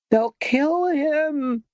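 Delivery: high-pitched scream. A narrator's reading. Esmeralda screams that the mob will kill her lover, piercing high. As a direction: let the words stretch into a piercing wail. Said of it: "screamed Esmeralda in an almost unbearably high-pitched".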